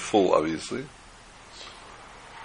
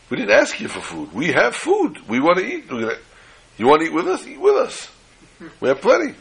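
high, eager voice of the guests, much higher than the teller; eager generous guests; 9 semitones higher than usual. Acted. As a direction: invite them in eagerly, pitched way up. Generous guests invite the family.

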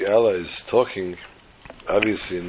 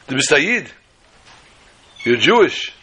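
officer shouting the question, higher and louder; retold scream; 6 semitones higher, 6 dB louder than usual. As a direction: bark it out in shock, loud and high.

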